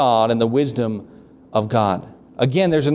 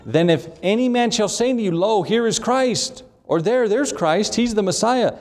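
high calling out; 7 semitones higher than usual. Acted. Voicing false prophets' cries.

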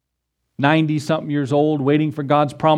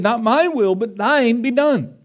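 higher, slower, pleading cry of surrender; Jesus pleading in the garden, higher and anguished; 8 semitones higher than usual. Retold or imitated. Jesus's prayer repeated, raised.

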